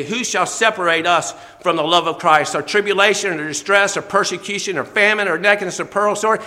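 loud, rising sermon build; preaching at full force. Acted. Preacher rallies congregation with scripture, loud and fervent. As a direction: thunder the list like a revival preacher.

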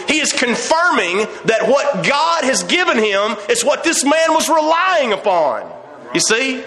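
high-pitched, drawn-out preacher's cadence; fervent preaching. Acted. Preacher on blind Bartimaeus clinging to faith, fervent preaching. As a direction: drive each point home with rising fervor.

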